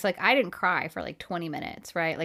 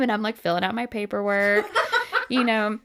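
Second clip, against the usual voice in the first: ordinary talk, nothing marked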